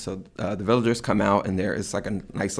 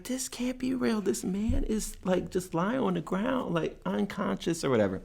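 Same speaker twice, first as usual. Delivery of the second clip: high disbelief; 10 semitones higher, 8 dB quieter than usual. Retold or imitated. Disbelief watching the doctor leave.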